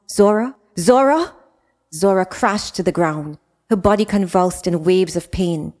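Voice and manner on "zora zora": rising louder with each call; alarmed calling. Acted. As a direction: call her name in growing panic. Sister collapses, calling her.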